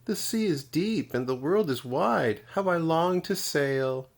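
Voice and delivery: a tiny snail sighing for the wide sea; wistful tiny snail. A narrator's reading. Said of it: "she sniffed and sighed".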